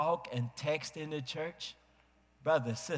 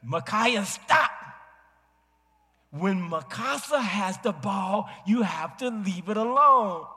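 louder, higher stern father command; stern shout; 7 semitones higher, 6 dB louder than usual. Retold or imitated. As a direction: shout a firm warning at the boy. Father scolding son.

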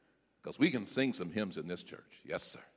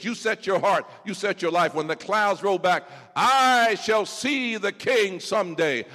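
building louder and slower; rising sermon climax; 7 semitones higher, 7 dB louder than usual. Acted. Preacher crescendo to the congregation, loud and slow.

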